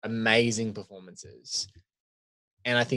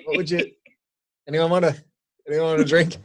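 lightly voiced bartender, pitched up; bartender from The Shining; 6 semitones higher, 3 dB louder, faster than usual. Imitating an offended host offering everyone a drink, bartender voice. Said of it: "like the bartender from The Shining".

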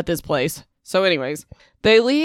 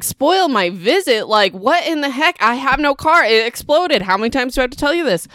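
higher, indignant mock rant with the last line rushed; exasperated dad; 6 semitones higher than usual. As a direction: play the fed-up dad, voice pitched up in disbelief. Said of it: "the dad's like".

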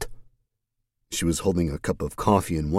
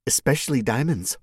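playful; 7 semitones higher than usual. Acted. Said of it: "Mercedes winked at him".

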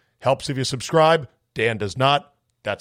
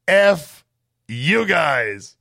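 louder, higher mock curse; emphatic swear; 5 semitones higher, 4 dB louder, much slower than usual. Host reading a listener's cheeky insult, loud and punchy. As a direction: hit the F hard. Reads listener's rude sign-off.

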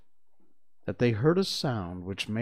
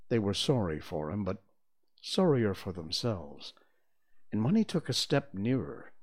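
usual speaking voice

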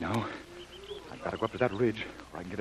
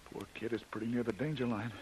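usual speaking voice

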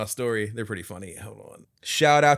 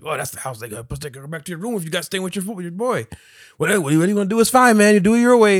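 higher, louder pushy driver voice; driver impression; 7 semitones higher, 6 dB louder, faster than usual. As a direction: push the offer like a sleazy driver, building up. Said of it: "And he's like".

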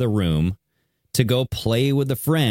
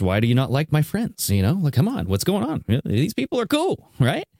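rising higher to a squeaky, puzzled plea; mock confused Elon; 5 semitones higher than usual. Host mocking Elon's confusion at her fear, squeaky and higher. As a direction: play him baffled, climbing in pitch. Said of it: "And Elon Musk was confused".

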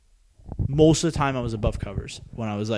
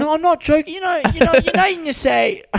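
put-on high, loud British accent; insistent mock-British ramble, pitched way up; British man impression; 13 semitones higher, 5 dB louder than usual. Quoting the video guy to a friend, high British impression. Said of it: "He's like".

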